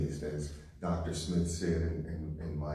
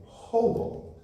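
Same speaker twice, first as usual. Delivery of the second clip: hoboed said much higher and louder, stretched out; punched word; 5 dB louder than usual. Shown as a demonstration. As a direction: hit hoboed hard and high for the crowd. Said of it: "say that again".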